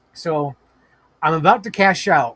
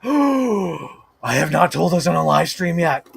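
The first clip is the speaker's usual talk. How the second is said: 1 surprised; slower than usual. Real, not acted. Gasps, realizes untold story.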